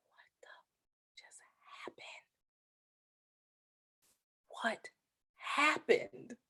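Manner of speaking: hushed, breathless disbelief; stunned whisper. Retold or imitated. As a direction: barely get the words out from shock. Stunned at number one ranking.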